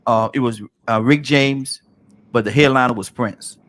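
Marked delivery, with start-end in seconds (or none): none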